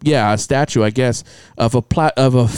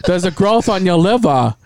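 higher, imitated Chinese accent; Chinese doctor; 5 semitones higher than usual. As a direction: mimic a Chinese doctor breaking news. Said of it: "my Chinese".

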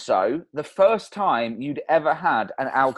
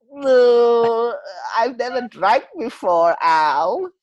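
loud, higher, alarmed refusal; Stu refusing drink; 5 semitones higher, 5 dB louder, much slower than usual. Retold or imitated. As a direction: yelp it like a nervous teetotaller. Acting out Stu's first drink.

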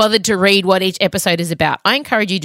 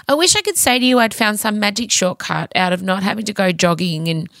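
ordinary talk, nothing marked

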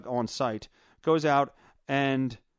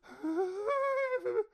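long, high-pitched mock wail; worked-up wife. Wife all worked up at the movie, mock wail. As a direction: imitate her whimpering in a high voice. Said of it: "all worked up".

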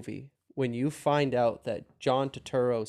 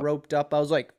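ordinary delivery

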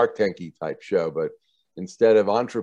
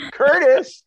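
calling out, laughing; 8 dB louder than usual. Retold or imitated. Mom calls boyfriend out, laughing.

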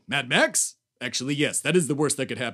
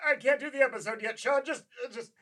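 distant, calling out from the bathroom; shouting through a closed door; from another room; 9 semitones higher than usual. Acted. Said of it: "tell the audience I'm in the bathroom".

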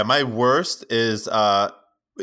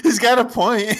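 high laugh; 14 semitones higher than usual. Real, not acted. Big laugh at the point.

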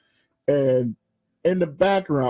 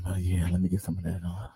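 lower, softer, fast sleazy voice; sleazy caller; 9 semitones lower, 9 dB quieter, faster than usual. Retold or imitated. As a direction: drop low and slide it in like a dirty joke. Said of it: "They'll be like".

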